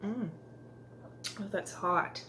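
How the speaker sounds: slurping a hot drink; sipping hot tea. Real, not acted. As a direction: talk between careful sips. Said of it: "I'm sorry for the slurping noise".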